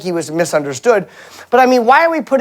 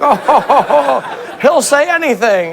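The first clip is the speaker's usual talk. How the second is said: higher, put-on jolly ho-ho; indulgent mock chuckle; 5 semitones higher than usual. Family humors unfiltered Grandpa, indulgent put-on chuckle. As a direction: chuckle fondly as if humoring him.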